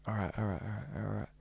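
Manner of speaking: fading "all right" under the breath; breathless self-soothing. Retold or imitated. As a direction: talk yourself down, barely audible. Calming himself, breathing deep.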